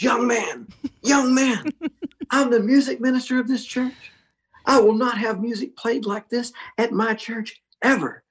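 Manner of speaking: put-on high scolding old man, pitched well up; stern music minister. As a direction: mimic the offended minister telling him off. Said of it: "He goes".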